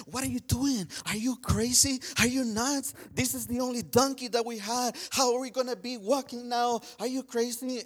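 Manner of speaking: high, alarmed wife's voice, pitched well up; frightened wife's outcry, pitched high; scared wife. Retold or imitated. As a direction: voice her panic at the husband, high and frantic. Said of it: "she was so scared".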